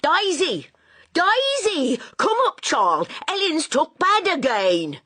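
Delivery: high, loud panicked calling up the stairs; screaming out. A narrator's reading. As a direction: call for your daughter in alarm. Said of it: "screamed out".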